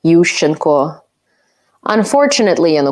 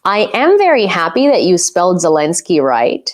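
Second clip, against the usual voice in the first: normal talking voice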